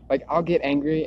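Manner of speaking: usual speaking voice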